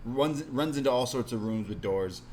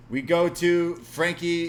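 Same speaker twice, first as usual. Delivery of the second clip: higher, louder, slow ring-announcer call; announcer intro; 6 semitones higher, 6 dB louder, slower than usual. Acted. Podcast host to listeners, slow hammed-up announcer call.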